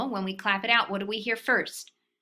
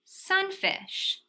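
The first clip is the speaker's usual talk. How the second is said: sunfish stretched slow and high; slow drawn-out blend; 10 semitones higher than usual. Shown as a demonstration. Teacher modeling blending the word sunfish. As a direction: draw out sunfish slowly. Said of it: "When we put it together".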